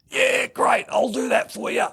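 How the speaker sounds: Smithy agreeing. Retold or imitated.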